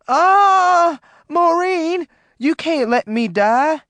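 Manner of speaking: loud, high terrified plea. Acted. John pleading with Maureen not to let him die. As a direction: plead for your life.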